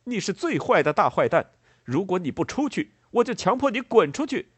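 loud, high, threatening shout; acted enraged ultimatum; angry. A narrator's reading. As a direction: threaten them furiously, voice climbing. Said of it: "愤怒的列莫尔说道".

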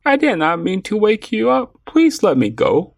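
high-pitched pleading mouse voice; begging little mouse. A narrator's reading. Mouse caught by a lion about to eat him, begging. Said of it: "kept talking and begging".

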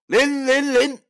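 loud, high chanted battle cry; 大声喊出咒语; shouted incantation. Acted. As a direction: bark the spell word out. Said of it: "只见他大喊一声".

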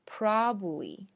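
probably squeezed to PROB-lee; probably without its middle "ba"; syllable dropped. Shown as a demonstration. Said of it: "会省略一个音节的发音".

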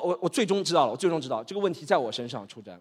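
ordinary talk, nothing marked

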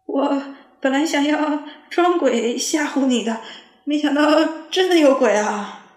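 stammering fright. A narrator's reading. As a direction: stutter it out, terrified. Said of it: "结结巴巴地说". (结结巴巴地说)

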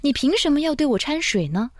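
indignantly puzzled question. A narrator's reading. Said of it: "我还是不解地质问".

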